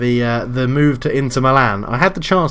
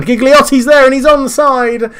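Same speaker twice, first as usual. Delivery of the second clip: much higher and louder, thrilled; excited shout; 11 semitones higher, 9 dB louder than usual. Real, not acted. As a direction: yell it as the striker breaks free. Equaliser chance in his game.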